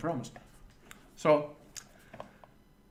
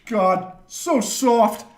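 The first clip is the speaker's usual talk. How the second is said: loud, high, drawn-out disdain; scornful mockery; 8 semitones higher, 10 dB louder, much slower than usual. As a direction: imitate a friend's slow scorn. Retold scornful friend.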